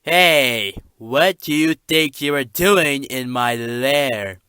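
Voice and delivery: "Hey" shouted, then slow and loud; loud villain challenge. Acted. Dragon character yelling at an intruder in his lair, loud and slow.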